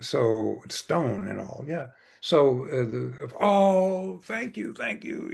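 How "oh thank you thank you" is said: high-pitched, acted gush of gratitude; overjoyed thanks. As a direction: imitate a happy farmer thanking his master. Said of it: "he was just so happy".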